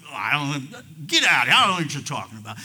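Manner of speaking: loud, acted outburst, rushing at the end; blustering as if cornered; flustered denial. Preacher as Peter fending off his accuser, flustered.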